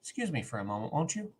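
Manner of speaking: hushed, mock-polite sarcasm; retold sarcasm. Fan quoting MacGyver excusing himself, softly sarcastic. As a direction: softly excuse yourself with sarcastic courtesy, lifting at the end. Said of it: "I love the sarcasm when he goes".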